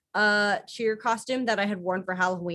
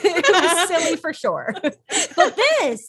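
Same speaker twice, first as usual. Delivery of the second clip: high laughing speech; 9 semitones higher, 7 dB louder, slower than usual. Real, not acted. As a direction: talk through a laugh. Laughing about old video.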